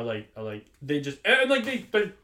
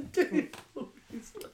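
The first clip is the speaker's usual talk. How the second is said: high, slow words through laughter; real laughter; 8 semitones higher, slower than usual. Real, not acted. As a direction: echo the title while giggling. Laughing repeat of co-host's title.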